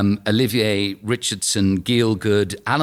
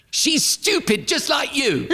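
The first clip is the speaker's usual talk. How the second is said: put-on high child voice; 14 semitones higher, slower than usual. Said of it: "my chap went".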